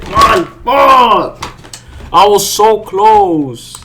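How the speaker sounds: yelled high and loud, then dragged out slowly; frustrated shout. Real, not acted. Trader yelling at his missed profit, furious shout. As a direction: let the loss explode out of you.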